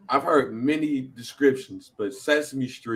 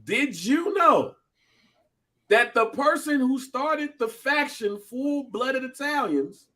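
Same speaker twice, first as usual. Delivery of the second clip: dramatic reveal; 13 semitones higher than usual. Acted. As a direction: pitch it high and draw out the suspense. Builds to a trivia reveal.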